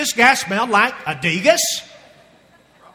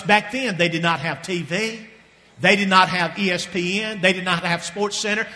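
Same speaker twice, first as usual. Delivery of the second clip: ordinary talk, nothing marked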